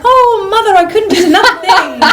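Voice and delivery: put-on high, laughing prim child voice; prim Victorian child, hammed-up and laughing; mock Cratchit child. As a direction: squeal it up high, gushing. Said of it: "And they're like".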